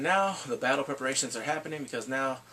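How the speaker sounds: ordinary talk, nothing marked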